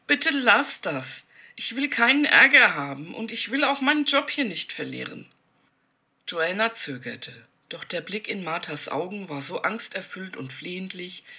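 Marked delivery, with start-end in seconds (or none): 0.1-5.3 desperate pleading. A narrator's reading. Martha begs Joanna to stay silent, desperately pleading. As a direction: plead as if her job depends on it. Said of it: "beschwor Martha sie".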